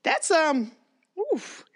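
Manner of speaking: high, gasping woofs at the rum; boss tasting strong cake. Retold or imitated. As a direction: react high to the strong liquor, woof by woof.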